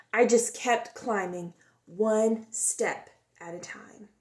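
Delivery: expressive storybook read-aloud; gentle, lively storytime voice; reading to children. A narrator's reading. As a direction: tell it like a picture book for kids. Reads picture book aloud.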